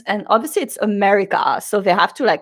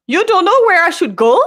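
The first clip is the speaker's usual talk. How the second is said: high, raised-voice disbelief; incredulous; 8 semitones higher, 4 dB louder than usual. Retold or imitated. Concertgoer questioning a clueless security guard, incredulous. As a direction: throw the question back, baffled.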